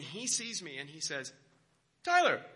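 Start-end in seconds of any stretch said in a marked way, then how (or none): 2.0-2.4 high, loud hail of a student; professor calling out. Retold or imitated. Professor spots his student at a conference, calling out. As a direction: call the name out loud and high. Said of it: "can't do the accent".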